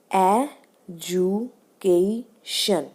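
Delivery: EDUCATION drawn out slowly in four separate beats; syllable by syllable. Shown as a demonstration. Teacher counting out syllables for the class, slow and separated. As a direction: break education into four slow syllables, pausing after each. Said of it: "four syllable है इसमें".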